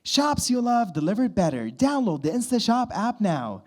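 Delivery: bright, high commercial voiceover; upbeat jingle-style ad read. Shown as a demonstration. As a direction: sell it like a radio ad. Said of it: "I've done a lot of the".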